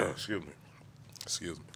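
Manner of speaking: quiet, choked back tears; fighting tears. Real, not acted. Award winner to the room, pausing quietly through tears. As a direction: hold the tears down and pull yourself together. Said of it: "my tears aren't because I'm sad".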